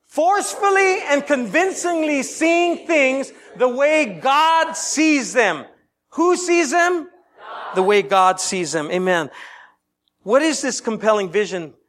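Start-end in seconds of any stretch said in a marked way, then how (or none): 0.1-5.6 high, very slow recitation, each word drawn out; slow preached recitation. Shown as a demonstration. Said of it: "One more time". 6.1-7.1 call-out. Real, not acted. Preacher calls for congregation response.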